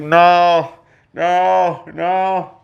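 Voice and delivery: mock wail of disbelief; anguished. Fan reliving his hero's death, anguished drawn-out protest.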